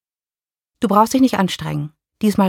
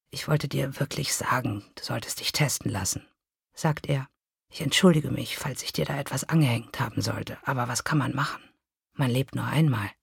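lower, softer fading apology; weak apologetic whisper; 5 semitones lower, 8 dB quieter than usual. A narrator's reading. Dying ex-lover apologizes to her, weak and soft. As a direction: say it with no strength left.

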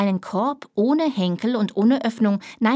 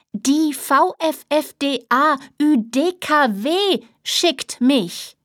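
slow, spelled-out letters, higher and louder; slower and clearer; 5 semitones higher, 3 dB louder, slower than usual. Acted. Nanny repeating to the dazed father, slow and deliberate. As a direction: repeat it as if to someone hard of hearing. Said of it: "diesmal etwas langsamer und deutlicher".

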